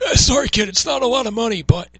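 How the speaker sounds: very loud, high mock salesman voice; John Laurinaitis. Salesperson letting the kid down on pay, hammed-up impression. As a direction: push the caricature high and hard. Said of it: "let me get back to the voice".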